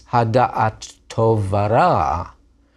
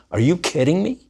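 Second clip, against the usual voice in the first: high, incredulous jeer; scornful disbelief; 6 semitones higher, faster than usual. Acted. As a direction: scoff at the kid. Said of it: "letting their spoiled kid brother know".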